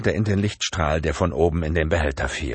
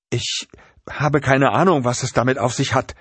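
blurted out; 7 semitones higher than usual. A narrator's reading. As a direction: gasp the words out. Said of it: "stieß sie hervor".

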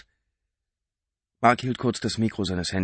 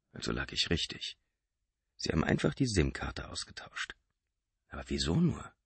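softer musing voice; inner thought; 3 semitones lower, 7 dB quieter than usual. A narrator's reading. Mark's inner thoughts in the taxi, soft. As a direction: think it quietly to yourself.

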